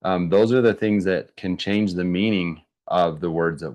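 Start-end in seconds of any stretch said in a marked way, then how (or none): none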